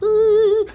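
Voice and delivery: high, loud "ooh" sound effect; sustained high-pitched "ooh"; imitated "ooh" noise. Shown as a demonstration. Imitates energy being pulled down.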